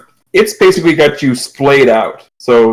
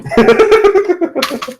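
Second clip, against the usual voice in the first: high, rapid giggle; laugh. Real, not acted. Game master amused by fish and chicken joke, high laugh. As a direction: burst into high-pitched laughter.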